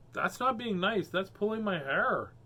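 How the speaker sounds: ordinary delivery, nothing marked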